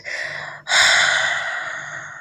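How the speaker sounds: drawn-out whispered exhale; slow overwhelmed sigh; long breathy sigh. Retold or imitated. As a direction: let a long sigh escape. Arousal peak in retelling.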